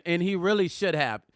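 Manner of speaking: normal talking voice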